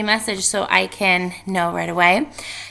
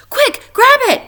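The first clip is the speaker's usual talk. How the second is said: high excited yell, much louder; 9 dB louder than usual. Acted. Littlest Rabbit yelling to the others to grab the nut. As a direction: shout it to the others across the grass. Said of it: "yelled Littlest Rabbit".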